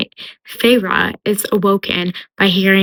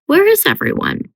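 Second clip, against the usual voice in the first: higher-pitched, puzzled call; puzzled wondering; 11 semitones higher than usual. Retold or imitated. Heroine wondering where everybody in the manor is, puzzled.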